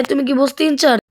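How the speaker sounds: usual speaking voice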